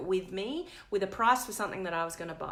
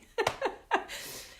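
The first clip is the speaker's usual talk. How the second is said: rueful laugh. Real, not acted. Laughs after retold outburst.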